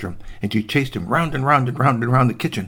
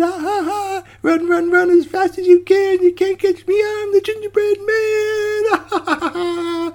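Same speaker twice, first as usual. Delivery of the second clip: very high, drawn-out taunting chant; laughing gingerbread man. Acted. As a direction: laugh and tease in a squeaky voice. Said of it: "turned his head and laughed".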